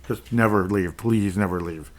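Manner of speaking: usual speaking voice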